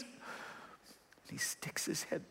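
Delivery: slow hushed whisper; preacher whispering the tense moment of the story. Real, not acted. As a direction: breathe it out slowly, suspenseful. Unvoiced slow narration.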